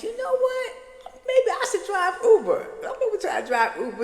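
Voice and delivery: high, bright burst of a new plan; excited idea. Retold or imitated. Telling her Uber driver she'll drive too, excitedly high. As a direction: pitch it up like a sudden great idea.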